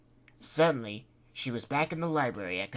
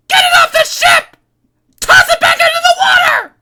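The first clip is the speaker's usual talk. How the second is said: much higher and louder frantic shriek; screaming; 22 dB louder than usual. Acted. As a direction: scream like she's losing it. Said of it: "But she kept screaming".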